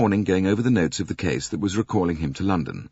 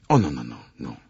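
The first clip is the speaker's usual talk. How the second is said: protesting; 6 semitones lower, faster than usual. Acted. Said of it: "protested Poirot".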